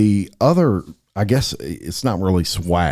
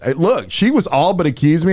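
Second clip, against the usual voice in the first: ordinary talk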